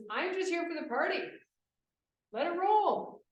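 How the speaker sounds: put-on party-boy bravado. Teacher quoting her partying student, loud carefree brag. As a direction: toss it off like he owns the room.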